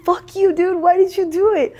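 shrieking retold argument; retold yell. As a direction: yell it back at her friend. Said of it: "I was like".

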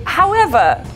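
ordinary talk

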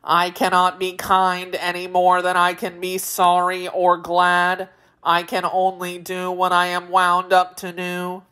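robotic monotone, every word on one note; mechanical machine man. A narrator's reading. Machine man scolding a captive Wheeler, robotic monotone. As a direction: speak like a wound-up automaton.

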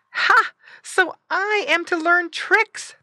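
very high, loud piglet voice; surprised little pig. A narrator's reading. Squinty in his box hearing of tricks, surprised. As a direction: squeak it out, taken aback.

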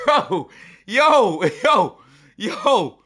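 high, loud disbelieving cries; stunned yelling. Real, not acted. Streamer reeling at the relegation zone, stunned yelling. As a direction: yell out each word in shock.

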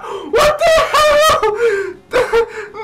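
real excited shrieking. Real, not acted. Gamer shrieking as the Crobat bites, laughing scream. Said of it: "You're making me lose my voice".

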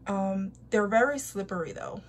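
speaking while smoothing face mask; fingers pressing around mouth. Real, not acted. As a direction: lips pushed by fingertips.

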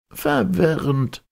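high, drawn-out exclamation; professor exclaiming. A narrator's reading. Professor repeating the count's word in protest, high exclamation. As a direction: cry it out high and slowly. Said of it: "rief der Professor".

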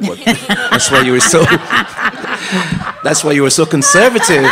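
laughing through the tease, words breaking into giggles; can't hold back the laugh while teasing. Real, not acted. Judge teasing the contestant about his pastor, laughing.

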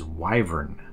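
"wyvern" with first syllable like "why"; correct long-i wyvern. Shown as a demonstration. Host giving viewers the correct pronunciation, long-i "wyvern". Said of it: "the correct pronunciation of this word is".

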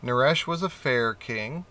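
ordinary delivery, nothing marked

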